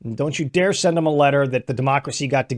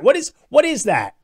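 incredulous; 8 semitones higher than usual. Real, not acted. Reacting to his own quote.

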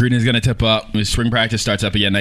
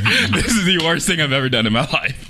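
laughing; 9 semitones higher than usual. Real, not acted. Laughing through spicy pain.

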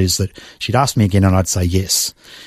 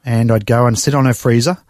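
ordinary talk, nothing marked

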